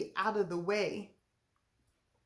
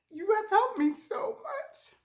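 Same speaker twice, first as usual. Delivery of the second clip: emotional; 11 semitones higher than usual. Real, not acted. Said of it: "I'm emotional".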